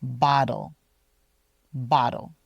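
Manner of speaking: Bottle with a D-like middle; flap T. Shown as a demonstration. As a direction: Bottle said like boddle. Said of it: "sounds a lot like a D".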